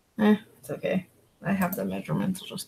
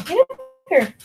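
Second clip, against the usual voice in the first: high, louder pet call; calling the dog; 10 semitones higher, 6 dB louder than usual. Real, not acted. Urging the dog up, high louder call.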